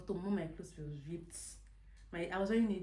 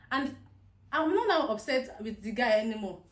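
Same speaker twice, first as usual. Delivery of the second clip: ordinary talk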